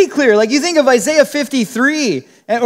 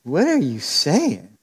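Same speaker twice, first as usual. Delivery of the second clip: lower, softer baffled question; puzzled reply; 9 semitones lower, 5 dB quieter, slower than usual. Retold or imitated. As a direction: ask it baffled, taken aback. Your puzzled reply to stranger.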